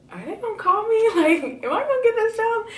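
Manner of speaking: giddy hopeful squeak with a laugh; excited high squeal. Retold or imitated. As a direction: squeal it like you can't believe it. Anxious hope after HR email.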